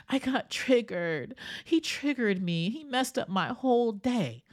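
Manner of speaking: griping about being wronged; upset complainer. Retold or imitated. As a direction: play the riled-up friend who got triggered. You go.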